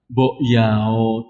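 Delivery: low, drawn-out coaxing; lightly acted devil's prompting. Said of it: "Setan kembali membisikkan".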